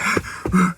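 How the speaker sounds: sharp, high gasp for air; imitated panicked gasp. Dreamer showing panic after surfacing from water, acted gasp. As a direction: act out gasping in a panic. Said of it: "I was, like, panicking".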